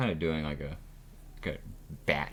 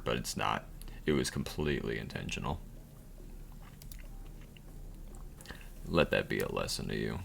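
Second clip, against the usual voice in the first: game narrator; 4 semitones lower, 4 dB quieter than usual. Acted. Narrator lesson.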